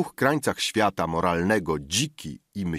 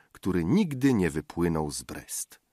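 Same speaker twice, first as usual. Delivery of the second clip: plain reading voice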